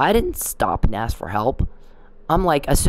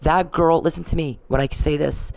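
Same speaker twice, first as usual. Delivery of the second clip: ordinary talk, nothing marked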